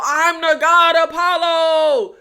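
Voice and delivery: slow, loud hammed-up hero cry; mock screaming. Reviewer mocking the hero's catchphrase, mock screaming. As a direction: proclaim it like an overblown god, mocking. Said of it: "screaming the name Emma at the top of his lungs".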